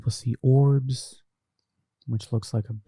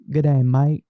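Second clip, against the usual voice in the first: Aussie greeting; 3 semitones higher, 6 dB louder than usual. Retold or imitated. Repeating the Australian greeting.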